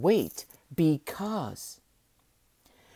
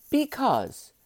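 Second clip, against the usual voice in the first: "because" set apart, higher and drawn out; stress on because; 7 semitones higher than usual. Shown as a demonstration. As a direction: lift "because" and let it fall slowly. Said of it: "have you noticed that I emphasize the word because".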